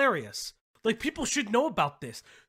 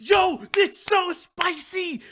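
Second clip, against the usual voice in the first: excited shout; 10 semitones higher, 4 dB louder, slower than usual. Real, not acted. Excited reaction to the clip.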